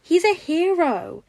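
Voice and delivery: high, loud and slow, beaming; a little boy bursting with pride; excited child. A narrator's reading. Toddler boasting to his papa about the hero, high and excited. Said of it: "an excited smile on his face".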